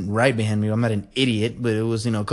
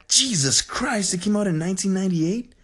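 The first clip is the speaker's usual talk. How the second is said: real shock; 7 semitones higher than usual. Real, not acted. Googling Rush Hour's release year, shocked. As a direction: blurt it out, aghast at the date.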